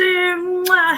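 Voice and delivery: loud high smacking kiss; high loud mwah kiss; air kiss. Real, not acted. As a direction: blow a big kiss. Blowing a kiss to viewer.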